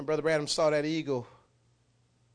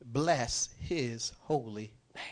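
slow scripture reading; 3 semitones lower, much slower than usual. Shown as a demonstration.

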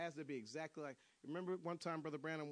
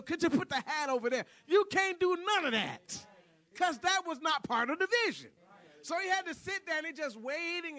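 loud, high, driving preacher shout; shouted sermon, pressing each phrase on the congregation; full-force preaching; 10 semitones higher, 13 dB louder than usual. Real, not acted. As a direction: preach it at the top of your voice.

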